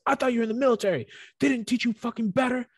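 hammed-up fast angry tirade; mock furious rant. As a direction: rattle off the insult in a rage. Retold ranting, fast.

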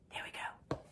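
soft whisper to herself; whispered aside. Real, not acted. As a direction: breathe it out as an aside. Aside while setting up iPad.

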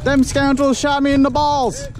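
hammy outraged cowboy. Pretend. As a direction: ham up the wounded old-timer. Mock outrage after hit.

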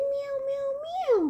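high meowing kitten; high little meows; cat meows. Retold or imitated. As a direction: mew it like the cat. Imitating cats.

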